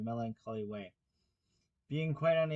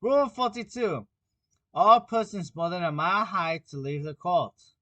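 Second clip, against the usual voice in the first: loud, high proclamation; pompous reading; 5 semitones higher, 10 dB louder than usual. A narrator's reading. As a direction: proclaim it like a decree. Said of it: "read out from his book".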